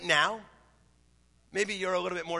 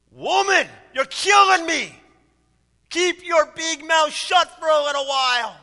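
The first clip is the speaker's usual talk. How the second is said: high, very loud husband's outburst; exasperated acted bellow, sustained at full volume; angry shout; 9 semitones higher, 10 dB louder than usual. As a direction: yell at your wife to stop talking. Husband explodes at his wife.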